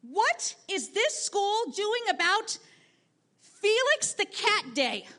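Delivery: high, slow, indignant demand; demanding student. Retold or imitated. Boy demands to know about Felix the Cat Day, high slow indignation. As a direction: lift the voice high and drag each word out in outrage. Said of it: "Jacob demanded to know".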